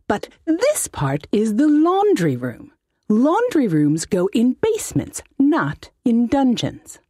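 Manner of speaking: high, put-on voice of a fussy old halfling mage; high-voiced wizard. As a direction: whine it like a pompous little wizard. Wizard explains laundry room.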